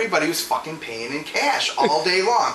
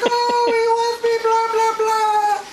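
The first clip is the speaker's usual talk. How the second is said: high, drawn-out mock wail; mock sobbing; slower than usual. Sobbing customer whines to clerk about being left, mock wailing. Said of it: "then she starts sobbing and everything".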